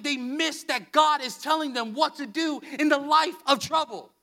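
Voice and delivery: normal talking voice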